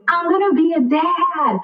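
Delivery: high, drawn-out imitation of a proud proclamation; announcing to the room. Imitating new dad telling a room of guys, elated announcement. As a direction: proclaim it high and slow, thrilled. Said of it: "turned around to his room".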